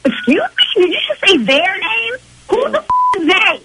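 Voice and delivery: high-pitched, incredulous anger; outraged disbelief. Real, not acted. Girlfriend catching her cheating boyfriend's slip, outraged. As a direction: demand an answer, pitch jumping up in outrage.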